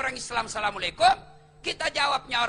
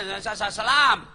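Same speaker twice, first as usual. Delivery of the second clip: hurried salam shout; 7 dB louder than usual. Retold or imitated. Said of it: "Orang mau masih bicara".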